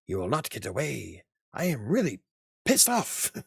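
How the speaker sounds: mock robot fury, climbing to a high finish; angry film robot. Fan acting out Short Circuit chase, hammed-up angry robot. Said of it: "the scene from Short Circuit where he's chasing".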